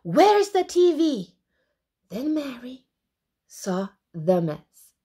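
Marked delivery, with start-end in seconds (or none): 0.0-1.3 high, loud cross question; angry sister's yell; shouted demand. Acted. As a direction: shout it on bursting in. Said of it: "shouted Mary".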